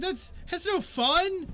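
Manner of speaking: high, slow sulking whine; whiny npc complaint. Acted. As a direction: drag it out like a sulking kid. NPC complains about the game.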